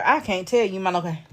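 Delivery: quick, rushed phrasing; hurried quote of the caller; fast retold quote. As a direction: rattle it off fast. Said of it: "Baby, he said".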